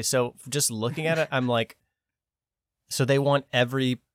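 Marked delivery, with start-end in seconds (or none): none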